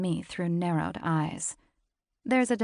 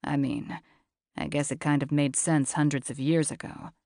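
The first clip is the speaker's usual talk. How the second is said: lower, husky-free steady man's voice; low male voice; 3 semitones lower than usual. A narrator's reading. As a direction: voice him low and cool.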